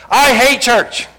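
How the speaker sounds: loud, high mock hostility; imitating rough outburst. Preacher imitates stranger starting a conversation roughly, hostile outburst. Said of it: "start off conversations like that".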